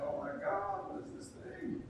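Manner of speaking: whispered high alarm; exhausted parent. Retold or imitated. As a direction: gasp in sleepless disbelief.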